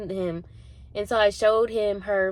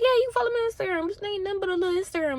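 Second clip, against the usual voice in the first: fast mocking reply; 11 semitones higher, much faster than usual. Mocking the Instagram request.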